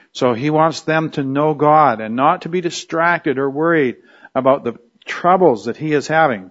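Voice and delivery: usual speaking voice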